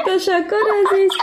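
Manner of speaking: thanks through a high laugh. Real, not acted. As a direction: still laughing while thanking. Host thanks caller while laughing.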